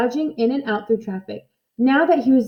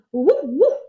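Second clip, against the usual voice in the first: a light, higher woof woof; imitated bark; 5 semitones higher than usual. A narrator's reading. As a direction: bark back like a happy dog. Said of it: "replied Scamp".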